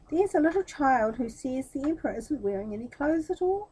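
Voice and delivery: ordinary delivery, nothing marked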